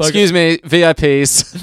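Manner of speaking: mock maître d'. Mock announcing their VIP arrival.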